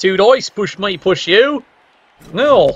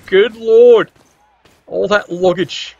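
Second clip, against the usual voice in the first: ordinary talk, nothing marked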